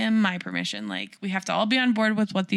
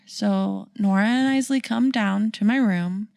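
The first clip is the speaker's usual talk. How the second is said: normal talking voice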